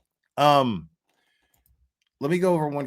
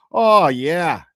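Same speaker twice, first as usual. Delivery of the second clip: a louder mock Canadian fan gushing; lightly imitating an eager caller; 2 semitones higher, 8 dB louder than usual. Host mimicking a Canadian caller praising him, mildly. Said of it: "they're like".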